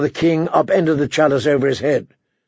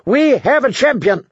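high, crowing royal proclamation; gleeful cry; shouted; 10 semitones higher than usual. A narrator's reading. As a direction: announce it to the whole hall, pitched high. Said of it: "he shouted".

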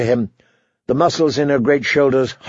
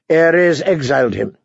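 plain reading voice

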